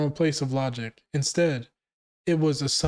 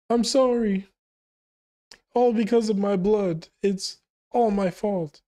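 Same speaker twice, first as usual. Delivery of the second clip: higher-pitched, pleading apology voiced as a crying mother; 5 semitones higher than usual. Mother apologizing to her child through tears, mildly voiced sobbing. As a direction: read it like a mother in tears, voice up high. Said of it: "And she's crying".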